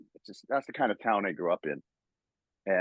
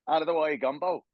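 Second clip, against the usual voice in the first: higher, fast mock Australian accent; 7 semitones higher than usual. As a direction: ham up a bad Aussie Wolverine. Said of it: "that Australian dude trying to play Wolverine".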